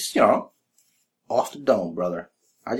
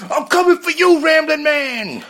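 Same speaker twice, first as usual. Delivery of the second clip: high, loud callout shout; wrestling callout; 5 dB louder, slower than usual. Acted. Calling out Ramblin' Man, shouting. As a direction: yell the challenge.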